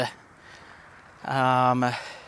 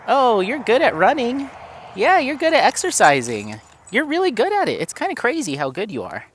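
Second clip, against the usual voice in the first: much higher, sing-song praise for the dog; pet talk; 9 semitones higher than usual. Real, not acted.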